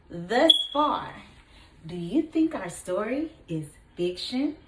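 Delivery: ordinary talk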